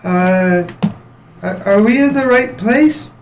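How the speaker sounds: put-on high, hesitant young girl; timid girl voice. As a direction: ask small and unsure.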